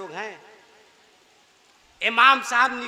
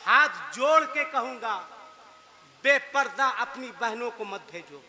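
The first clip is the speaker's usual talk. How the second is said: normal talking voice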